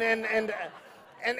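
ordinary talk, nothing marked

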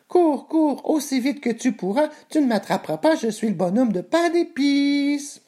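very high, squeaky sing-song mockery; taunting gingerbread man. A narrator's reading. Gingerbread man taunts the pig chasing him, high mocking chant. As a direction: jeer in a tiny voice, stretching the last words. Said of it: "Le narqua ce dernier".

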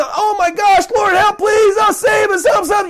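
high, panicked pleading shout; frantic yelling. Acted. Castaway to a boat that won't stop, frantic yelling. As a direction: beg at the top of your range. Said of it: "You're gonna yell even louder".

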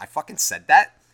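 mock-astonished outburst; acted disbelief. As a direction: blurt it out, amazed at yourself. Acts out own surprised reply.

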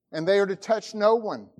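ordinary delivery

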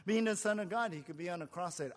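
ordinary talk, nothing marked

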